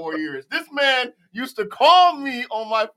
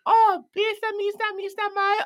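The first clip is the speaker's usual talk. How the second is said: very high, repetitive radio caller; high mock summons; Julie; 14 semitones higher than usual. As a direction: imitate a coworker calling over the radio, pitched way up. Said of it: "how would Julie call you on the radio".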